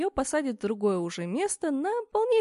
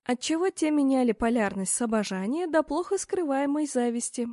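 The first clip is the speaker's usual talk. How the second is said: ordinary delivery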